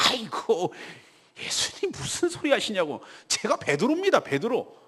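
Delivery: exaggerated sigh into indignant boasting; mock bragging. The old Peter boasting to Jesus, mock indignant. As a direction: play a cocky, protesting disciple without real volume. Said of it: "이렇게 큰 소리 쳤을 건데".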